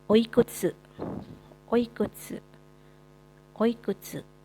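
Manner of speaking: oikutsu said clearly, each syllable even; teacher modeling oikutsu; model pronunciation. Shown as a demonstration. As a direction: drill oikutsu for the class. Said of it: "Selanjutnya ada".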